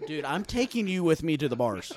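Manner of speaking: ordinary talk, nothing marked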